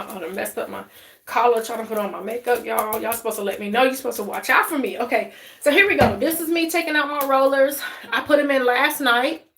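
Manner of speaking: ordinary talk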